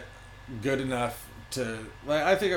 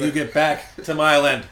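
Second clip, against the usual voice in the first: mock hostile local; 4 semitones higher, 10 dB louder than usual. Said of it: "like, a French person".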